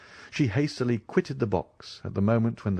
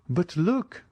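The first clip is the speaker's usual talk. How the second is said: higher, urgent call to look; sudden exclamation; 9 semitones higher than usual. A narrator's reading. Madame Danglars urging the Count to look, excited. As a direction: point something out in a rush. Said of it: "exclaimed Madame Danglars".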